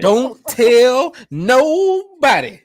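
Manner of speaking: very high, loud, drawn-out squeal; mock secrecy. Joking hush after offering ticket deals.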